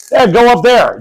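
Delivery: acted parent calling a kid onward; urging parent. Parent sending child to stranger's house.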